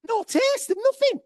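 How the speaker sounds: high-pitched indignant yelp; outraged complaint. Retold or imitated. Telling the crowd about the tasteless wine, high and outraged. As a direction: squeal it like you've been robbed. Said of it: "I'm fucking livid".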